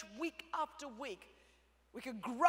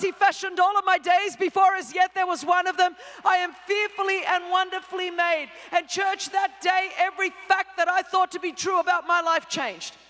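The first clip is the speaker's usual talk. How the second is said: loud preacher's proclamation of scripture; full force preaching; 6 semitones higher, 12 dB louder than usual. Acted. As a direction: declaim it like from the pulpit.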